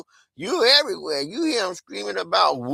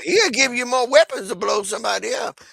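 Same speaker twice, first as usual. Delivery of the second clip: usual speaking voice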